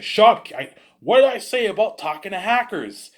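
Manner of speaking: high, loud yell; angry cop. Retold or imitated. Cop yells at the kid for asking questions, angry yell. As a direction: snap at him for talking back.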